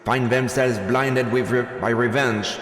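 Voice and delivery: loud, ringing speech to a crowd; rallying speech. Acted. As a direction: address the cheering crowd like a rebel leader. Rebel leader's rally speech.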